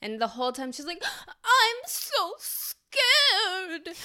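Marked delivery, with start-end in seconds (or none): 1.4-4.0 put-on breathy, high old-movie damsel; trembling classic film heroine; old Hollywood starlet. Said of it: "they all talk like this".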